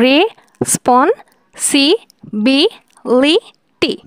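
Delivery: Responsibility said very slowly, six separate beats; syllables drawn out. Shown as a demonstration. Teacher counting six syllables, very slow. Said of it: "এটা হচ্ছে ছয় সিলেবলের".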